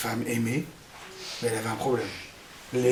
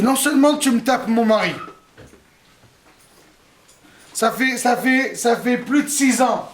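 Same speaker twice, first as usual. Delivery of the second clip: high, loud indignant rant; indignant Léa; 5 semitones higher, 3 dB louder than usual. Acted. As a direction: scold your sister in outrage. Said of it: "Léa qui s'emporte". (Léa furieuse, voix haute et forte)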